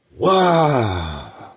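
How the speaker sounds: awed shout. Real, not acted. Awe at the dragon image.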